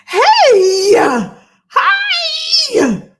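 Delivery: high, loud happy hello; excited shout. Real, not acted. Greeting joining viewers.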